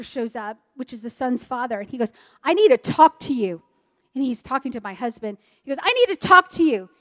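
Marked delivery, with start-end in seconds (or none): 2.4-3.6 loud, insistent mock confrontation; retold stern demand. Pastor confronting her husband, a retold stern demand. 5.8-6.9 higher, louder imitated confrontation; retold forceful demand. Pastor confronting her husband, forceful retold demand.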